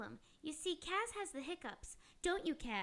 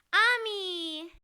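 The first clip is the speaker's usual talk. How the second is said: shout; 12 dB louder than usual. Acted. Drops disguise, shouts her name.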